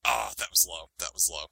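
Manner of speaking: low, soft mock offense; mock hurt. Reacting to a cheap shot from a friend, mock hurt. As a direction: pretend to be wounded. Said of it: "mock offense".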